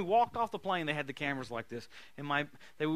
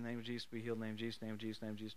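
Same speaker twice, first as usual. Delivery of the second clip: murmured prayer; 6 semitones lower, 9 dB quieter, faster than usual. Retold or imitated. Praying down the healing line.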